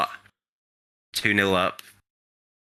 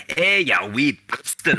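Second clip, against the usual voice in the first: loud, higher mock Scottish shout; booming Scottish; 8 semitones higher, 3 dB louder than usual. Footballer going over to confront the linesman, in a booming Scottish voice. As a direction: boom it out in a big Scottish voice. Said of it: "his big Scottish booming voice".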